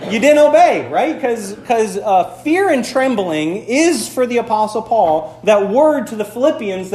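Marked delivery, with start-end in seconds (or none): 0.1-1.2 louder, higher, emphatic. Real, not acted. Pastor pressing the congregation, loud and emphatic. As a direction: drive the point home.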